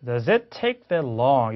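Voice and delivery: "Does it take that long" slow, starting da-zit; linking demo. Shown as a demonstration. Teacher demonstrating for learners, "Does it" linked slowly. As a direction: slowly link "Does it" in the question. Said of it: "이런 발음이에요".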